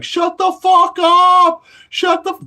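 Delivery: very high, loud, slow furious yell; angry inmates yelling. Retold or imitated. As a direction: bellow it like a fed-up prisoner through the bars. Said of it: "Every other prisoner in the place is like".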